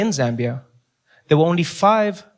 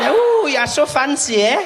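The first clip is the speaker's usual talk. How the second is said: a woman won over by the escalator, in a high swooning voice; impressed woman; 8 semitones higher than usual. Retold or imitated. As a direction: gush like she's dazzled. Woman answering the pickup line.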